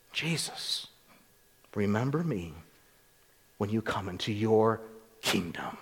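soft, faint plea with a whispered "Jesus"; barely able to speak, begging quietly; dying criminal's feeble whisper to Jesus; weak dying plea. Retold or imitated. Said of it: "It was probably very weak".

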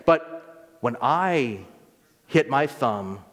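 normal talking voice

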